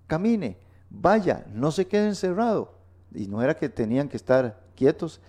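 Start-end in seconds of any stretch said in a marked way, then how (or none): 0.1-2.7 high-pitched, urging preacher commands; urgent high-pitched call to act; rousing exhortation. Real, not acted. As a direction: spur the listeners on, pitched well up. Exhorting listeners to get moving.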